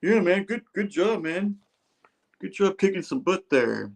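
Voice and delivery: a hint of a chummy tough guy; buddy praise. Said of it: "He's like".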